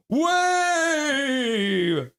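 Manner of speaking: high, drawn-out holler; playful high yell; acted chat cheer. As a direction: cheer one long word from the stands. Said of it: "And Eric Hunter's like".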